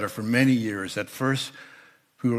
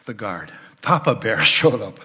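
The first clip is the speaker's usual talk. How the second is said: usual speaking voice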